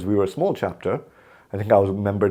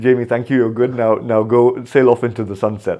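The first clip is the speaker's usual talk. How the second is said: cheery mock farewell, a bit louder; mock send-off; 4 semitones higher, 6 dB louder than usual. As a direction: play the board kindly showing him the door. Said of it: "it was a wink, wink, nod, nod".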